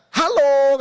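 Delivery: the devil barging in again with a bold hello; devil's greeting. Acted. Devil greets the believer who opens the door, loud and cheeky. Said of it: "katanya dia".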